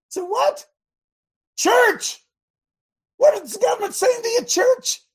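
high, loud preacher's cry. Real, not acted. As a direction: rouse the church, calling out high. Preacher cries out to congregation.

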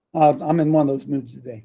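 usual speaking voice

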